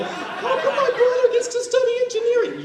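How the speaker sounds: put-on high-pitched complaining child; whiny adopted kid. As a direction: whine the unfairness like a sulking boy. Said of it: "My adopted kids".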